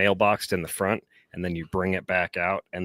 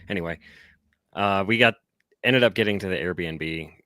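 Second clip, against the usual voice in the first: usual speaking voice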